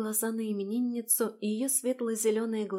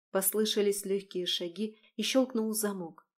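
usual speaking voice

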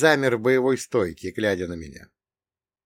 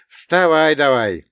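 louder, higher, drawn-out urging; insistent wake-up call; 5 semitones higher, 8 dB louder, slower than usual. Acted. Man shoving a sleeping girl to get up, insistent call. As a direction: get the sleeper up, loudly and slowly.

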